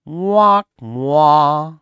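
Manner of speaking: loud mock "wah wah" baby cry. Mocking players as crybabies, loud fake wail. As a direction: bawl like a crybaby, hammed-up.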